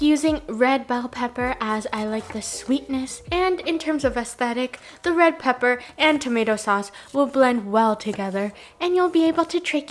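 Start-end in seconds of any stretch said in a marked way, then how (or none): none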